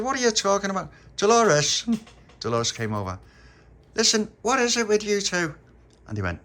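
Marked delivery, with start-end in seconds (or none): 0.0-1.9 put-on high, loud child's protest; protest, then holler for your friend; baffled, calling out. Baffled child calls friend over. 3.9-5.5 put-on high, loud, exasperated child; exasperated challenge. Child confronts two odd friends.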